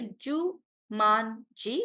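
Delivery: "Jumanji" slowly as Ju-man-ji; syllable by syllable. Shown as a demonstration. Teacher going through students' answers, syllable by syllable. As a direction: space out the three beats of "Jumanji". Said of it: "we are just breaking words".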